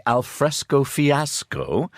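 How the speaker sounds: slow, high, drawn-out Al fresco fiasco with fresco rising; headline read out. Retold or imitated. Reading the Times headline to the host, slow and high. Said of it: "They say".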